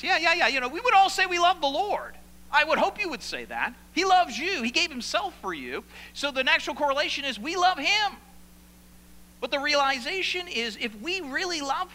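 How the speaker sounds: loud, high, driving sermon voice; fervent preaching. Acted. Preacher urging his congregation, loud and high. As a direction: preach it out with full force.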